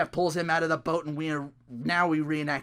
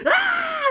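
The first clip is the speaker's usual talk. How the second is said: mock scream; 8 dB louder than usual. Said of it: "he's like".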